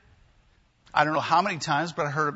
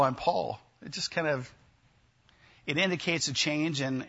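normal talking voice

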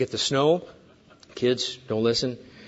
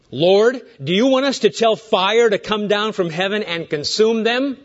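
raised louder, higher voice of eager disciples; 6 semitones higher, 4 dB louder than usual. Retold or imitated. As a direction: call out keenly, asking permission to burn them. Disciples eager to call down fire.

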